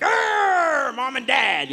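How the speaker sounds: loud, high comic roar; mock angry growl. Comic showing his teen anger at parents, loud mock roar. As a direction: rage like a sulky teen. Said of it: "unresolved anger".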